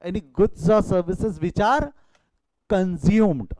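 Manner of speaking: very slow, stretched definition; slow dictation repeat. Shown as a demonstration. Teacher repeating the definition slowly so students copy it. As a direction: drag out every word for the class. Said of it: "यहाँ important word क्या है".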